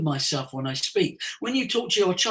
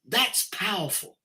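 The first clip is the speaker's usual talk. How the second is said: ordinary talk, nothing marked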